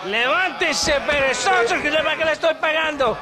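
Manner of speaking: high, loud scolding, fast at the end; angry shouting. Acted. Officer angrily shouting at his sleeping soldiers. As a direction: berate the lazy soldiers.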